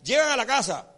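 ordinary talk

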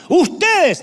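high, loud hailing shout; shouting to the street. Acted. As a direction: yell at the passers-by to stop and listen. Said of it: "dice la sabiduría". (grito agudo y fuerte para llamar a la gente de la calle)

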